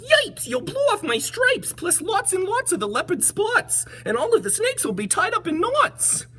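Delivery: high alarmed zebra yell. Acted. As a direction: yelp it in alarm. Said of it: "The zebra yelled".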